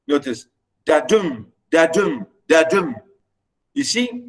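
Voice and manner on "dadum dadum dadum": dadum repeated evenly, light da and heavy DUM; low-up iambic beat. Shown as a demonstration. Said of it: "you start low and go".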